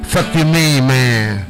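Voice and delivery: ordinary talk, nothing marked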